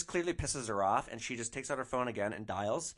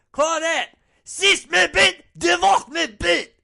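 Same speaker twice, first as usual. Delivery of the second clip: full-volume, high-pitched French insult; furious yell; 16 dB louder, slower than usual. Retold or imitated. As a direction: yell it after the passenger with everything you have. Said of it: "I just yell".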